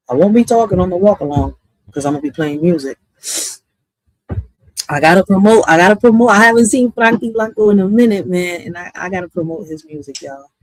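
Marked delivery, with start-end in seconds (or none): 4.9-8.3 excited. Real, not acted. Hyping a friend's music.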